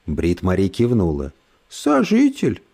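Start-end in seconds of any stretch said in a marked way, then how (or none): 1.7-2.6 sugary condescending lady. Acted. Брит Мари язвит.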